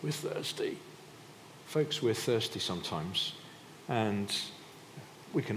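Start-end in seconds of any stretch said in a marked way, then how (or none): 0.0-0.8 high, airy whimper of thirst; mock complaining. Israelites complaining of thirst, high mock whimper.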